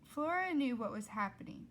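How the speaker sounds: ordinary delivery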